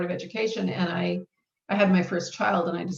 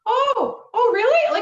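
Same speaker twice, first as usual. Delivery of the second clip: high, loud laughing "oh, really?"; laughing surprise; 9 dB louder, slower than usual. Retold or imitated. Author retelling her agent's call, laughing in surprise. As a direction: laugh out a stunned disbelief.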